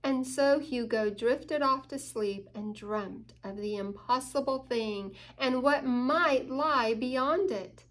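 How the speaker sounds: ordinary delivery, nothing marked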